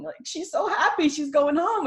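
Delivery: ordinary delivery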